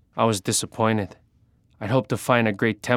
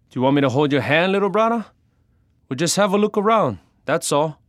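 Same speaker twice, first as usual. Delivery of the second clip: high, taunting big-brother voice; pitched-up, dismissive mocking; teasing older brother; 7 semitones higher, 3 dB louder than usual. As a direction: tease a little brother for being scared. Older brother teases a nervous younger one.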